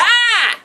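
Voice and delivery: high sudden yelp of a hello; high mock greeting cry. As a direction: bark out a bright hi like a performer. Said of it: "it's like".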